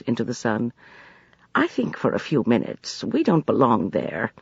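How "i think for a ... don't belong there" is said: plain reading voice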